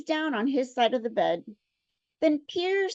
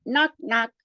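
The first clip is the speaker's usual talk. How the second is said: ordinary talk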